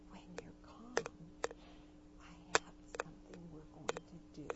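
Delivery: hushed whisper in the ear. Retold or imitated. Teacher whispering to a boy mid tantrum, hushed whisper. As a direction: whisper it low and calm, right into his ear. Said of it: "I whispered in his ear".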